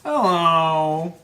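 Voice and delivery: high-starting, loud, drawn-out hello; calling out. Real, not acted. Partner joins calling the cat, loud singsong. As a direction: sing it out to the pet.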